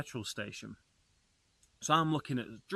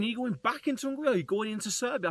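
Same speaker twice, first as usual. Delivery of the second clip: rapid questioning; 9 semitones higher, much faster than usual. Retold or imitated. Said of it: "He was like".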